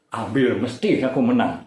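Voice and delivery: ordinary delivery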